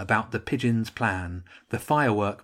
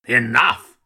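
roared; 8 dB louder than usual. A narrator's reading. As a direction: roar it to silence the troops. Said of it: "the Archduke roared".

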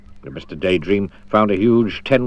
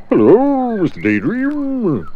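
high, drawn-out elephant greeting; trumpeting elephant; 12 semitones higher, 4 dB louder, slower than usual. Acted. Elephant greeting Mister Daydream, high trumpeting voice. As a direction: trumpet it out through a trunk. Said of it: "trumpeted the elephant down its trunk".

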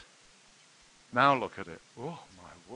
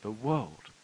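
ordinary talk

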